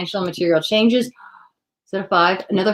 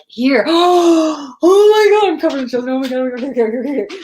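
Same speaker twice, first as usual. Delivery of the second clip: high, loud, gasping mock panic; overwhelmed student; 7 semitones higher, 5 dB louder than usual. Imagined pupils crowding the new teacher, in mock panic. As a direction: play swamped learners, gasping and flustered. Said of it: "people are gonna be like".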